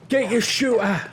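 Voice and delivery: high, drawn-out Cockney army shout; obnoxious Cockney Brit. Acted. Mocking the army Brits in Warzone, obnoxious Cockney shout. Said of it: "the army and the Cockney Brits".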